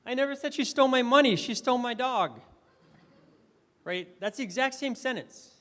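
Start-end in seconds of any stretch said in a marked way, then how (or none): 0.1-2.4 quick, much higher and louder, money stressed. Shown as a demonstration. Speaker demoing stress to the audience, stress on money. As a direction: fire it off fast and high, landing on money. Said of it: "I emphasized different words".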